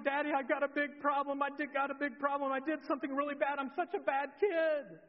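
put-on high, loud childlike wail; imitated crying. Daughter confessing to Daddy that she was bad, mock tearful. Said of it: "and she's crying".

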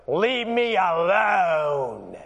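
put-on drawn-out, shouted teen protest; irritated teenager. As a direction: imitate a teenager wailing to be left alone, slowly. Moody teenage daughter snaps back, acted.

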